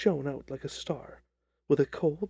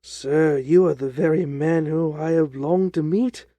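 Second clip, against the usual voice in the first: loud, impassioned little fellow; eager cry; 7 dB louder than usual. Acted. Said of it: "cried the little fellow".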